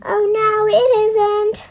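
high, loud pantomime shout; children calling out. A narrator's reading. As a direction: call back like a panto crowd. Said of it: "they all called out".

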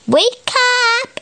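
high ringing alarm call; shouted. A narrator's reading. The clock shouting at sleepers, high. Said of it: "It shouted".

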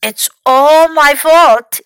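loud, drawn-out lament; very upset. A narrator's reading. Fox blames himself for Louis's fall, very upset. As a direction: wail the blame slowly and loudly. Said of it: "Fox was very upset".

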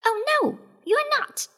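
very high little rabbit voice; Clever Rabbit. A narrator's reading. Rabbit contradicts the lion, very high voice. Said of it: "said Clever Rabbit".

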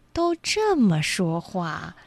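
sloth. Pretend. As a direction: stretch every word like a sloth. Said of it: "用树懒的方式来说话".

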